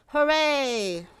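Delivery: high, loud, distant echo cheer; echo shouting back. A narrator's reading. The echo shouting back to the friends, far off and loud. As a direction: throw the cheer back from afar. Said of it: "the echo shouted back".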